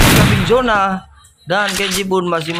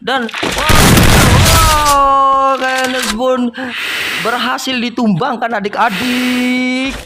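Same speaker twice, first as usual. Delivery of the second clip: excited; 5 semitones higher, slower than usual. Acted. Big moment in the match.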